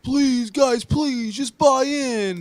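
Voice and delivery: high, loud pleading; mock begging coach. Host mimics Dan Campbell pleading with players, high begging. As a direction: beg like a desperate coach. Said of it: "And then when he's like".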